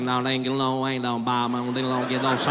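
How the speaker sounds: low, mumbled Southern gibberish; lightly voiced Southern man, gabbling low. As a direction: mumble it low like a Kentucky local. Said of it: "friend was like".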